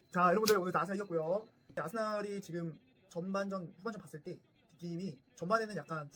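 stiff, formal summary to the viewers; formal review. Acted. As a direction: wrap up like a pundit's review. Post-match review to camera. (격식 차린 경기 리뷰 말투)

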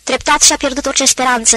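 ordinary delivery, nothing marked

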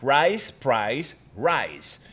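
usual speaking voice

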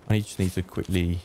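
usual speaking voice

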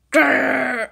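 low play-acted growl; zombie growl.

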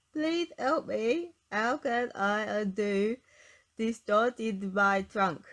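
slow, comical knotted-trunk voice; funny elephant voice. A narrator's reading. Elephant with knotted trunk begs for help in slow funny voice. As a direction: talk funny, as if your trunk were knotted. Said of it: "in a very funny sort of way".

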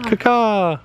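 high screechy crow caw; shrill screeching bird woman. Shown as a demonstration. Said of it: "a call that I do for the birds".

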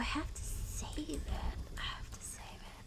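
hushed unvoiced whisper. Real, not acted. Whispering to chat while sneaking past enemies. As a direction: keep it to a breath so nobody hears. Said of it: "I don't know why I'm whispering".

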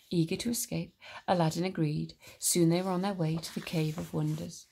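plain reading voice

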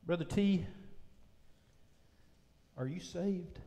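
hushed preaching. Real, not acted. Preacher testifying that he is saved, hushed and low. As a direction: drop right down and let each line settle.

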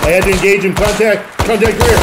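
high, loud contact call; combat shout. Real, not acted. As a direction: yell it over gunfire. Firefight breaks out.

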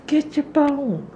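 high, loud, slow order to the puppy; high-pitched pet command; high dog command. Real, not acted.

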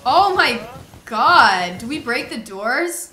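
slow, loud oh my God; loud panic. Real, not acted. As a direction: blurt it out in a panic. Panicking at shaking doors.

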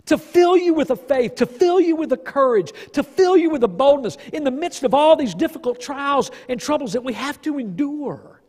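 fiery sermon build; preaching at full force. Real, not acted. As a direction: preach it up to the climax. Preaching climax.

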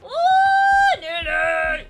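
loud high thrilled whoop; excited yell. Real, not acted. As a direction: cheer the near miss. Near miss excitement.

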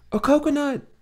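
put-on high, urgent young woman; Munera's voice. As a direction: call out for a coconut.

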